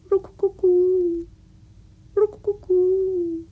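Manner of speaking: high, slow rook-coo-coo bird call; imitated dove's fluting call. A narrator's reading. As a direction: coo like a dove, stretched out and loud. Imitates the doves calling.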